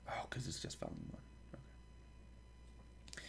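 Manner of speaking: barely audible whisper; whispered aside. Real, not acted. Reader working out the list to themself, whispered aside. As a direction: whisper it to yourself. Aside to self while checking pages.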